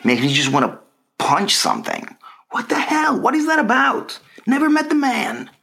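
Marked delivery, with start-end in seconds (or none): 2.5-5.5 much higher, exasperated rant; high-pitched indignant rant about Tom Hanks; mock outrage. Real, not acted. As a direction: squeal it out in disbelief at yourself. Ranting about hating Tom Hanks.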